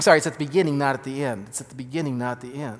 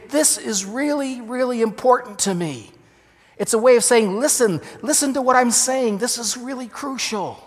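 higher, pressing on "really" and "listen"; urgent emphasis; 8 semitones higher, 4 dB louder than usual. Shown as a demonstration. Pastor voicing what the doubled words mean, urgently. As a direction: insist it truly matters. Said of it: "it was a way of saying".

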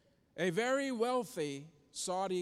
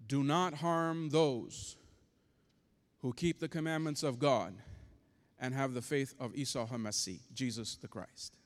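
lower, measured angelic command; very calm voice; 5 semitones lower than usual. Retold or imitated. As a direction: imitate an angel calmly giving a command, pitched low. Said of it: "this angel in a very calm voice".

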